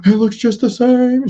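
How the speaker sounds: gasp, then high loud mock squeal; imitating excited wife. Imitating wife thrilled at seeing a movie house, high excited squeal. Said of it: "My wife's like".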